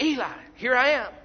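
acted boy answering a summons; eager calling out. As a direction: call out to report for duty.

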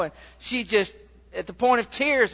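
ordinary delivery

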